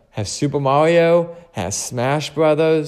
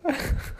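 short laugh; 7 dB quieter than usual. Real, not acted. Laughing at own story.

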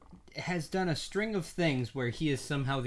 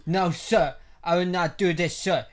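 mock Jaden accent; 4 semitones higher, 7 dB louder than usual. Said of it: "a Trump impression".

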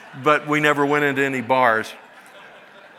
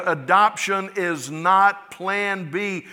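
ordinary delivery

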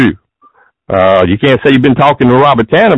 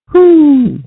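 high whew; 13 semitones higher than usual. Real, not acted. Exhale after ranting about clerk.

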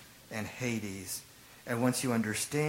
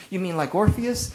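louder, higher scoffing "you mean like Orpheus"; mocking question; 7 semitones higher, 6 dB louder than usual. Preacher mimicking Athenians who never said it, mocking. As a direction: toss it off like a sneering philosopher. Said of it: "They didn't say that".

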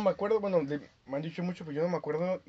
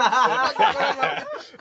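loud high laugh bursting out; cracking up hard at the story; high burst of laughter; 11 semitones higher, 10 dB louder than usual. Real, not acted. Laughing hard at his friend's kinder story, loud high burst of laughter.

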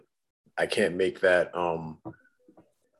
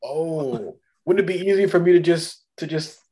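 higher-pitched, hesitant mock-innocent offer; Vision; 6 semitones higher than usual. Vision offering to end the fight himself, mock-innocent. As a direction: play a helpful hero tentatively piping up. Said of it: "Vision could have chimed in".

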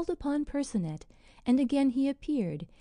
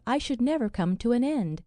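plain reading voice

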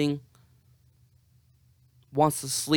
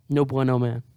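ordinary talk

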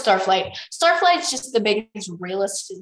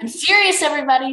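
raised, louder call for attention; urgent insistence; 7 semitones higher, 7 dB louder, faster than usual. A narrator's reading. Starflight warns his dragon friends, urgently serious. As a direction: insist on being heard.

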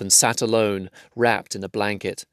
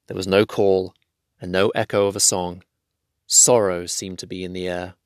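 usual speaking voice

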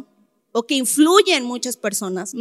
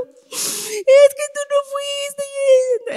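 much higher, slow tearful reproach; crying reproach; 12 semitones higher, much slower than usual. Retold or imitated. Crying daughter accusing her father of not coming, sobbing high. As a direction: let the words break into crying, very slowly. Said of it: "estaba llorando".

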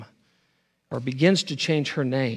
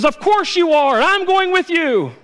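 Samwise; 14 semitones higher, 7 dB louder than usual. Retold or imitated. Sam answering Frodo he goes with him, high loud vow. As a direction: refuse to be left behind. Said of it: "Samwise Gamgee says".

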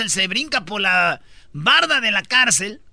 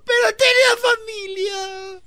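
mock wailing child; 12 semitones higher, slower than usual. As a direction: wail it like the heartbroken boy. (llanto exagerado de niño)

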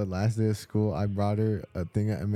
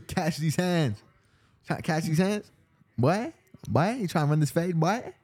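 higher, goofy tough-guy taunt; mock fight challenge; 6 semitones higher than usual. Slang challenge to a fight, mock-confrontational. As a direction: pitch it up and call them out, jokingly.

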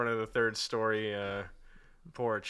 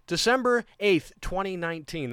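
December eighth said higher and louder; emphatic restart; 6 semitones higher, 5 dB louder than usual. Shown as a demonstration. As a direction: reset and say the date boldly. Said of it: "resetting to Windows default?".

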